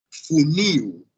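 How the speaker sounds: "funil" stressed on -NIL, ending like "niw"; stress on last syllable. Shown as a demonstration. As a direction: land firmly on the NIL of "funil". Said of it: "a sílaba forte é a última sílaba".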